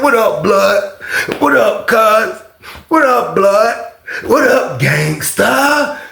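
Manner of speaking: high, slow, drawn-out gangsta greetings; mock gangsta greeting. Mimicking [name] greeting the crowd on TV, high and slow. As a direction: ham up the rapper calling out to the crowd.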